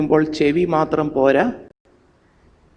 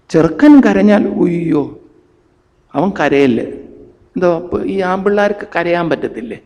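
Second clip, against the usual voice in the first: ordinary talk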